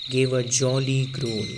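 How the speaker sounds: usual speaking voice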